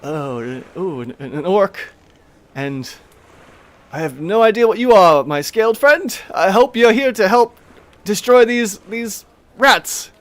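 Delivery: taken aback by an orc; Tamili surprised. Acted. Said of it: "she just goes".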